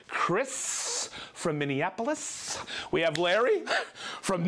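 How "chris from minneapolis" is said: slow, stretched final s sounds; rolling drawn-out delivery. Shown as a demonstration. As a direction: roll it out slowly, holding each final s. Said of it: "That has a roll".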